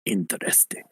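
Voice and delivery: a soft, intrigued whisper. Acted. Rebel leader intrigued by the recruits, whispering. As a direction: breathe it out, quietly interested. Rebel reacts to the party joining.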